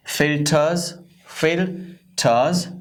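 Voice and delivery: फिल्टर्स as "fel-taaz", no R; slow, drawn-out फिल्टर्स ending in "taaz"; silent R. Shown as a demonstration. Teacher modelling for learners, फिल्टर्स without R. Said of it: "आर नहीं पढ़ना".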